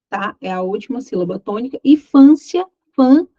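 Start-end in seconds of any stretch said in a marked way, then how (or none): 1.8-3.3 "fância, fã" with FÃ punched high and loud; single out "fã" in "fância", then repeat it alone, forcefully; stressed syllable demo. Shown as a demonstration. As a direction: hit "fã" hard, higher and louder, as the stressed syllable. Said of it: "é a penúltima sílaba tônica".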